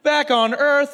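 high, loud, bewildered voice waking up; a dead man jolted back to life, confused; startled Lazarus. Retold or imitated. Lazarus waking up back on earth, loud and startled.

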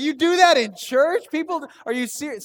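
loud, high, scandalised questioning; incredulous comic outburst; acted disbelief. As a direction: exclaim in hammed-up horror. Acts scandalised reaction to wife.

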